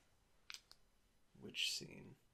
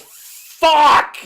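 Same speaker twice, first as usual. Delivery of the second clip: full-throated scream, much higher and louder; 17 dB louder than usual. Retold or imitated. Jeff screaming as Pony starts another song. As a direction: let the rage burst out in one scream. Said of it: "and just screams".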